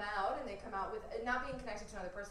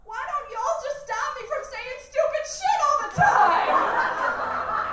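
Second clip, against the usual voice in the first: high, loud, drawn-out holler; hammed-up exasperated shout from another room; kid brother yelling; 15 dB louder, slower than usual. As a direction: yell it slowly, way up high. Young brother yelling from kitchen.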